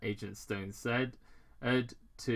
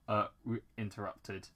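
ordinary talk, nothing marked

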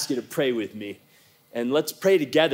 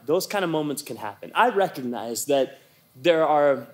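ordinary delivery

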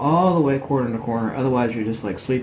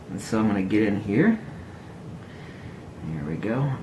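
talking out of the side of the mouth; out of mouth corner; 3 semitones lower, 4 dB quieter than usual. Real, not acted. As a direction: lips barely open, words pushed sideways. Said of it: "I'm gonna talk out of the corner of my mouth here".

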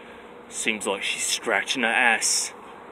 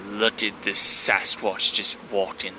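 normal talking voice

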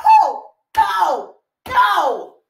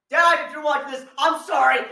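usual speaking voice

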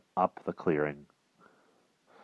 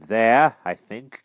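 great satisfaction; 5 semitones higher, 8 dB louder, much slower than usual. A narrator's reading. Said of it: "in a tone of great satisfaction".

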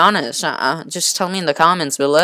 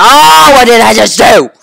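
loud frustrated cry, higher; real anger, a loud pitched-up outburst; mad; 7 semitones higher, 11 dB louder than usual. Real, not acted. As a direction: yell it out in frustration at the game. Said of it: "I'm real mad right now".

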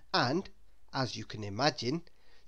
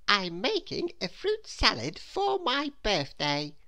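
higher, slow little Miss Scatterbrain voice; surprised Scatterbrain; 6 semitones higher, slower than usual. A narrator's reading. Little Miss Scatterbrain replies about her birthday, surprised. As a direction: draw it out in a higher character voice.

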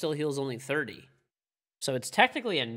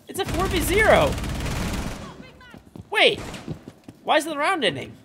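surprised; 6 dB louder than usual. Real, not acted. Confused by bugged round ending.

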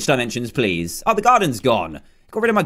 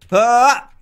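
frustrated yell; 8 semitones higher, 5 dB louder than usual. Real, not acted. Missing the Spider-Man jump.

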